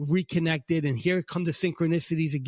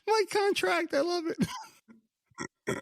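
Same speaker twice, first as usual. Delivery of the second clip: laughing; 12 semitones higher, much slower than usual. Real, not acted. Laughing at the host's joke.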